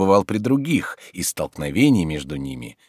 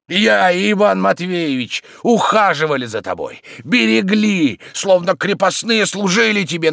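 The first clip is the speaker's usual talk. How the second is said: high, loud indignant harangue; angry demanding tirade; 12 semitones higher, 6 dB louder than usual. Acted. Tarantyev demands half the fortune, angry tirade. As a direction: press the accusation hard and insist. Quarrel, demands money from Oblomov.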